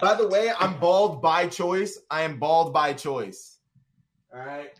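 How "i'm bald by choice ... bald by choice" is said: slow, louder, each word spaced out; insistent. Real, not acted. Defending his bald head to the chat, slow emphatic insistence. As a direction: drive the point home word by word.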